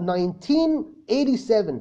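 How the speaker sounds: nineteen eighty-seven split into two pairs, slow. Shown as a demonstration. Teacher shows a student how to say the year, slow and clear. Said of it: "لا، قولها".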